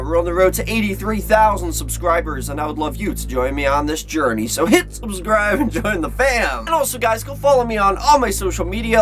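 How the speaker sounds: high, bright host energy, fast in places; upbeat vlog intro. Acted. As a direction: hype up the channel like a YouTuber. Energetic YouTube channel intro.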